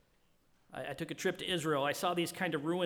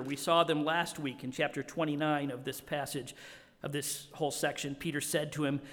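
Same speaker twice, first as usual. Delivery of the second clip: normal talking voice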